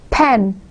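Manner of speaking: pen in one puff of air; one-syllable demo. Shown as a demonstration. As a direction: say pen as a single isolated beat. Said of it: "so it has one syllable".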